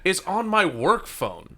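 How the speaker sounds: high, slow, drawn-out teasing defense; hammed-up indignant excuse; exaggerated mock excuse. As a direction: stretch it out like a whiny alibi.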